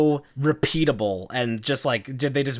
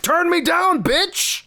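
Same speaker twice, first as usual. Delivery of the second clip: very high, loud retort; mock screaming defiance; retold screamed comeback; 4 dB louder, slower than usual. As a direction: yell back high-pitched, hammed-up. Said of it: "Stop screaming".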